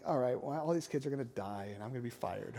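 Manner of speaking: half-joking dread, dropped low and soft; mock dread. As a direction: sink into doomed private panic. Imagined panic on the mission walk.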